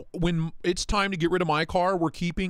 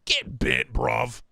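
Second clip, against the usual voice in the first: lower, a lightly put-on British accent; mock British accent; 4 semitones lower, much slower than usual. Dismissing bent blades, a mock British accent. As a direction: toss it off like a cheeky London lad in a British accent.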